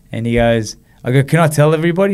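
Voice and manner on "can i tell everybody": high, loud, bursting with joy; about to burst with the news; overjoyed. Retold or imitated. Asking the doctor if he can share it, excited and loud.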